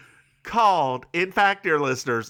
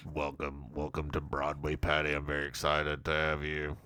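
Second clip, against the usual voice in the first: low character voice; 11 semitones lower, 9 dB quieter than usual. Retold or imitated. Voicing Barry's speech.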